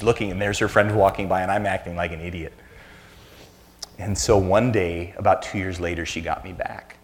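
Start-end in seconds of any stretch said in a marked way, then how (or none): none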